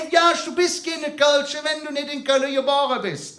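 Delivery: mock colleague insisting you must be born in Cologne; imitating the colleague. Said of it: "mein Kollege sagt für mich immer".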